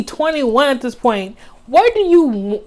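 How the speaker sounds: ordinary delivery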